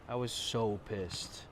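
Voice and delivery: low slow muttered repeat. Real, not acted. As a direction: drop the voice and mutter it slowly. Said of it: "and I was so pissed".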